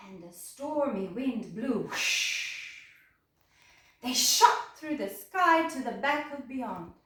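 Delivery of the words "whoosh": plain reading voice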